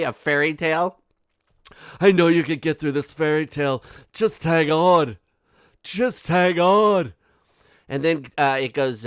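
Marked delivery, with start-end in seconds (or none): 2.0-3.8 loud, quick mock plea; mocking encouragement. Mocks the letter's encouragement. 4.1-7.1 loud, drawn-out plea, building on the repeat; mock-dramatic encouragement. Mocks the letter's encouragement, building.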